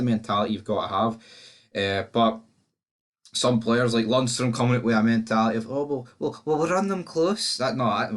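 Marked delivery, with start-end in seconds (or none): 5.7-7.6 mocking Scouse accent, higher and slow at the end. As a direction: sneer at a player with a put-on Liverpool accent. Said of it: "his wee pish Scouse accent".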